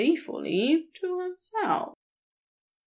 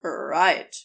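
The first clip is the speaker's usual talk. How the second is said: lower, fond voice for Crowley; 7 semitones lower than usual. Acted. Crowley speaking fondly to his weapon, lower and grinning. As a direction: grin through it, fond of the weapon. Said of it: "grinned fondly as he spoke to it".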